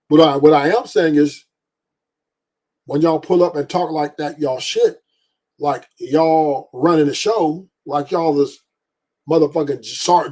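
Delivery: ordinary delivery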